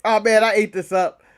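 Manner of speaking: usual speaking voice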